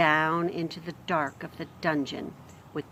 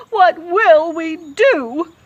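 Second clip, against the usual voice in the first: higher, louder, slower climbing wail; 10 semitones higher, 11 dB louder, much slower than usual. Acted. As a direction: let the wail rise further. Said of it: "wailed Cook".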